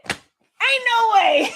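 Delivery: retelling her stunned reaction, laughing; drawn-out laughing ain't no way; laughing disbelief. Retold or imitated. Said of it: "I said".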